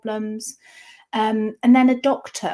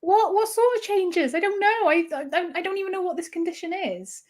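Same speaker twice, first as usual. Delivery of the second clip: retold panicked questions; 9 semitones higher than usual. To the consultant after the diagnosis, high bewildered pleading. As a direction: pitch right up, lost and scared.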